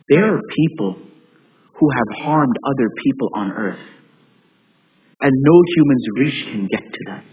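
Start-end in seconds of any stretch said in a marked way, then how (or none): none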